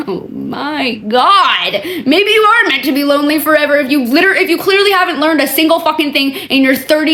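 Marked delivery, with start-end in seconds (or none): 0.1-1.7 slow laughing groan. Real, not acted. Laughing in disbelief.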